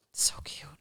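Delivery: soft whispered aside; tiny whispered so cute. Real, not acted. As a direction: breathe it out as a whisper.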